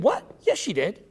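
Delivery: ordinary delivery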